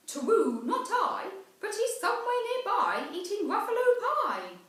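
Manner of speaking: a bold owl hooting down from the tree. Acted. Owl answers the child.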